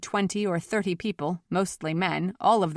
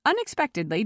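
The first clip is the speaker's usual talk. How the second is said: plain reading voice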